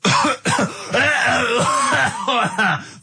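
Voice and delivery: coughing fit. Real, not acted. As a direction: hack and splutter, then laugh it off.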